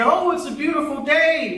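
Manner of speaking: high, airy window-gazer; mock admiration. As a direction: gush lightly over the weather, in imitation. Acts window-gazer admiring the day.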